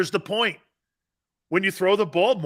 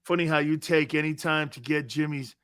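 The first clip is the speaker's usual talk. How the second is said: ordinary talk, nothing marked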